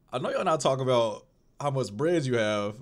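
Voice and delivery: Jesus as an exasperated modern friend; lightly voiced, annoyed Jesus; modern-slang Jesus. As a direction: make Jesus sound like a fed-up buddy. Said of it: "he didn't talk like this in the Bible".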